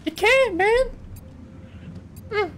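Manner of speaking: very high mocking squeal; mocking squeak. Real, not acted. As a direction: squeak it out to taunt. Mocks enemy's weak weapon.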